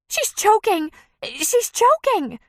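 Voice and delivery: high, loud panicked shriek; women screaming in alarm; panicked cry. Acted. Panicked women at lunch, shrieking.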